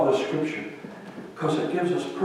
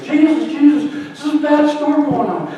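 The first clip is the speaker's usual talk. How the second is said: mock alarm; 10 semitones higher, 4 dB louder than usual. Disciples wake Jesus in storm.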